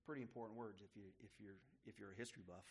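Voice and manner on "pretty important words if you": ordinary delivery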